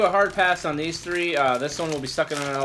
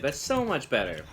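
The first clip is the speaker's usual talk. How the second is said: ordinary talk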